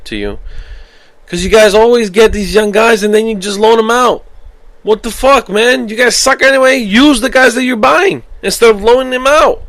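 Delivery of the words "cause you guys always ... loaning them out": loud, high-pitched exasperated tirade; angry rant. Real, not acted. Fan scolding Chelsea over loaned youngsters, angry rant. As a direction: lay into them, voice pushed up high.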